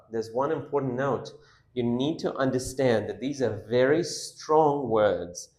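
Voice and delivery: ordinary talk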